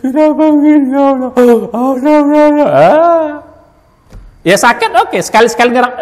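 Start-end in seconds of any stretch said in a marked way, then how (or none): 0.0-3.3 a hint of a whiny sick person, higher; lightly voiced grumble at illness; mock complaining. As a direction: act out a complainer moaning to God. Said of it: "tidak banyak ngeluh".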